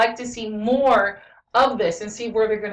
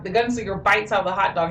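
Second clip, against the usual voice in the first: ordinary talk, nothing marked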